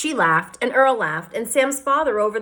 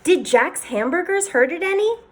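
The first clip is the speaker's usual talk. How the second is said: put-on lightly raised boy's voice; a young boy asking, a touch higher; child voice; 10 semitones higher than usual. A narrator's reading. Andy's voice in read story.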